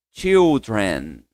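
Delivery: put-on Children with a ch like T; model pronunciation. Shown as a demonstration. Teacher models children for students. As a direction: say Children, tch start. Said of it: "é comigo".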